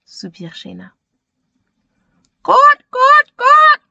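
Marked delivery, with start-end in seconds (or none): 2.4-3.8 high, loud clucking; hen clucking. Acted. The lost hen answers Shayna with loud clucks.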